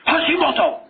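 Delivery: hammed-up aggressive Japanese-accented exclamation; mock Japanese accent. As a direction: burst out in an exaggerated guttural Japanese accent. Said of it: "Do you ever hear a Japanese person say Hashimoto?".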